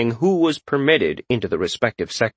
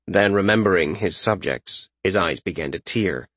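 plain reading voice

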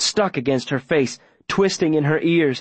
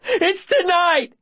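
very high, drawn-out yell; desperate shout; slower than usual. Acted. Boy giving up the secret to save her, desperate shout. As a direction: shout the confession with everything. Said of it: "I shout".

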